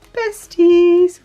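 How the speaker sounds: Besties popped out high and loud; playful high loud Besties; sing-song exclamation. Shown as a demonstration. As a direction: announce the word like a cheer. Sticker name said with flourish.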